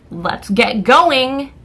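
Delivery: impatient. Retold or imitated. Customer wanting to tap a foot in line, impatient. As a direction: stretch it like someone fed up with waiting. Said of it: "I wanna start tapping my foot".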